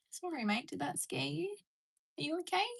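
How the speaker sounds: apologising tenderly to a scared bird; soothing the bird. Real, not acted. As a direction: coax a frightened pet softly.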